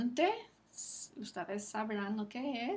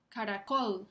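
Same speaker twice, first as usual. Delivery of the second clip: "Caracol" with the last syllable stronger; stress on COL. Shown as a demonstration. Teacher repeats for students to hear, stress on COL in "Caracol". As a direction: lean on COL at the end of "Caracol". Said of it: "Está sobre la última sílaba".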